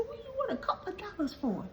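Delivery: high, fast, disbelieving challenge; incredulous question. Retold or imitated. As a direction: fire it back in mock disbelief. Incredulous retold question to beggar.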